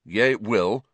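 ordinary delivery

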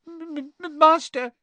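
very high stammer on the first sound; stutter, then squeak it out; Winky. A narrator's reading. Frightened elf pleading with master, stammering. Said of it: "Winky stammered".

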